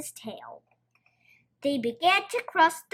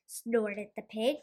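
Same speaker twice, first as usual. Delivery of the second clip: ordinary delivery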